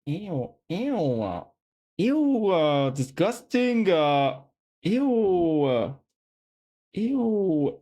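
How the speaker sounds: drawn-out, swooping "ew"; hammed-up revulsion; play-acted disgust. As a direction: recoil as if from something gross. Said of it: "disgusted. Looking at something gross".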